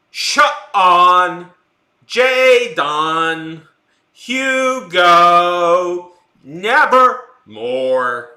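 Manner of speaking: loud, drawn-out calling in a bad French-American accent, the last words very slow; hammed-up French actor searching for his lost son; mock French-accented calling. Streamer imitating the Heavy Rain father calling his son, loud French-accented call. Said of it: "he says it in such a funny way".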